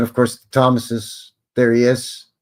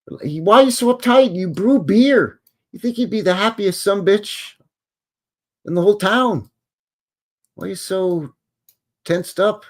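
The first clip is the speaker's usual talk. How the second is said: mock exasperation; 11 semitones higher, 5 dB louder than usual. Real, not acted. Scolding an uptight portrait.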